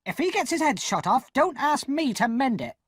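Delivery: snapped high and sharp; a sister's biting retort, pitched high. Acted. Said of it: "my sister said sharply".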